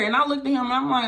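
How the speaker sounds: ordinary delivery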